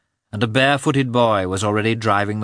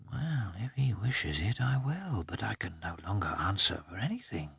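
soft inner voice; 12 dB quieter than usual. A narrator's reading. Dog's resigned thoughts.

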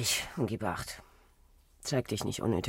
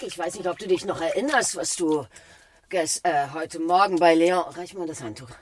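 rising insistence; 4 semitones higher, 7 dB louder than usual. Acted. Drängende Vorhaltung an Feck.

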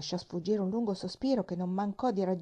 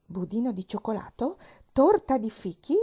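Budino and Torta thrown out higher and louder in disbelief; indignant outburst; 3 semitones higher, 6 dB louder than usual. Acted. Aunt Polly scoffs at her niece's cooking, outraged. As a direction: scoff at the very idea. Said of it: "sbottò la signorina Polly".

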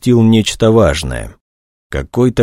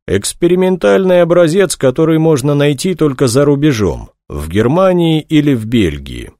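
lightly voiced colonel, higher pitch; a hint of an official reporting, pitched up; 7 semitones higher than usual. A narrator's reading. Colonel tells investigator about the drug, reporting in raised pitch.

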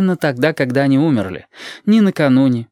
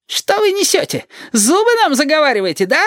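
very high-pitched indignant outburst; acted young cop playing tough; irate interrogator. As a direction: accuse them of stalling, voice shooting up. Blond cop explodes at the pair.

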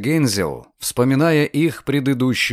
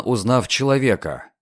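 ordinary delivery, nothing marked

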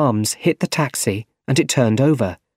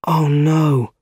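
higher, drawn-out dismay; alarmed thought; 5 semitones higher, 3 dB louder than usual. A narrator's reading. Nephew sensing danger at the bank, alarmed. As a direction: let dread dawn on you. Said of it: "he thought".

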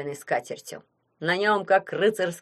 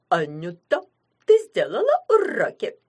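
parrot mimicking mum; 5 dB louder than usual. A narrator's reading. Parrot asking Anya in mum's voice, squawky mimicry. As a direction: parrot squawk with a leaping pitch. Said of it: "произнёс маминым голосом".

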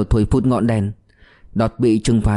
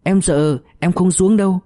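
higher-pitched scared refusal; understated acted fear; trembling fear; 7 semitones higher than usual. A narrator's reading. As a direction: plead mildly, as a frightened girl. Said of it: "Cô bé run hết cả tay chân".

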